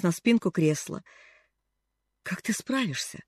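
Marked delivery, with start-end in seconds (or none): 2.2-3.2 much softer, faint; weak question. A narrator's reading. Ailing aunt asking the girl, faint. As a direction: barely voice it. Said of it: "слабо спросила она".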